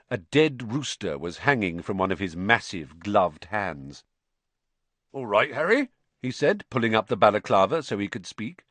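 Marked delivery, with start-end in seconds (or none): 5.1-5.9 a hint of hearty greeting; lightly voiced Hagrid. A narrator's reading. Hagrid meeting Harry in the corridor, lightly hearty. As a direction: greet him casually, a little higher.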